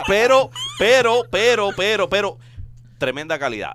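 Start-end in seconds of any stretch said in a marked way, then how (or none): 0.1-2.4 raised, laughing repeated interjection; insistent. Real, not acted. Host cutting through laughter to praise the kitchens, insistent. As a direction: push back loudly over the laughter.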